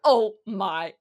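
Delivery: loud, hammed-up disbelief; overwhelmed amazement. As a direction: blown away, drawing out the shock. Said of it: "I was so overwhelmed by the answer".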